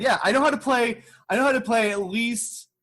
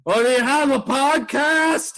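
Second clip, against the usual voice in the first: put-on nosy drunk; 6 semitones higher than usual. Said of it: "And he was like".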